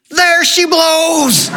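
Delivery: very high, drawn-out mock scream. Son spotting the whale off the bow, high acted scream. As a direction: scream it out like an old whaler's lookout. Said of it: "And Henry screams".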